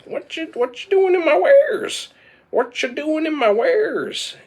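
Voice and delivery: very high-pitched gnome squawk; indignant gnome. Acted. Gnome confronting farmers over his wares, indignant squeak. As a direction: challenge intruders in a loud, squeaky little voice.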